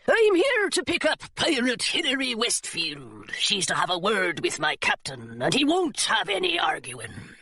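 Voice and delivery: put-on pirate voice, swinging pitch; brusque pirate mate delivering orders; pirate deckhand. Pirate mate speaks in story.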